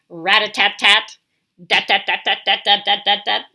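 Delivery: loud rapid rat-a-tat; loud hammering beak sounds; woodpecker drumming. Acted. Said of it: "The woodpecker sounds like a hammer".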